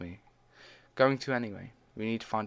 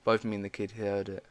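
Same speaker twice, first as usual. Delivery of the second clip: usual speaking voice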